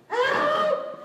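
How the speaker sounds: very high, loud scream; one piercing, stretched shout; desperate cry for help. Acted. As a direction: scream HELP as a prayer to God. Same prayer screamed.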